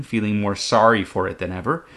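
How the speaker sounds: usual speaking voice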